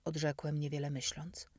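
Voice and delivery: plain reading voice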